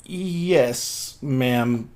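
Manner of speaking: slow, measured official voice; formal police officer. Acted. Officer asking the homeowner for identification, formal and measured. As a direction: explain it slowly and procedurally, with officious politeness.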